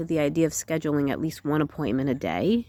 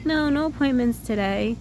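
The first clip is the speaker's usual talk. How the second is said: higher, easy carefree report; 8 semitones higher than usual. Shown as a demonstration. Trying out confidence in doing the job, carefree. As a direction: say it high, with nothing to apologise for. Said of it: "Just, like, carefree".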